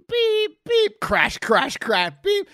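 high mock forklift beeps and crashes; imitated beeping and crashing. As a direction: beep it out like a reversing forklift. Imitating forklift noise at interview.

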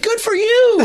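high-pitched, gushing imitation of approval; mock congratulations. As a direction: mimic overjoyed praise. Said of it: "with the way you say".

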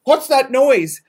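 mildly panicked passenger, retold; freaking out. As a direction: imitate startled passengers asking. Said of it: "the people next to me are freaking out".

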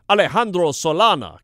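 Alejandro Solana with exaggerated Spanish vowels and a strong h; overemphasized Spanish. Shown as a demonstration. Host copying the player's overemphasized way of saying it. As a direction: lean hard into the Spanish Alejandro. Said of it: "He overemphasizes it".

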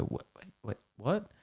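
barely-voiced, puzzled crowd murmur; confused murmur. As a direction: mumble it like a confused room. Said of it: "this murmur of silence and confusion".

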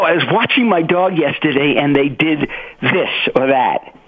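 ordinary talk

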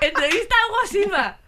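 high, loud headline announcement; mock headline. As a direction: announce it like a tabloid scoop.

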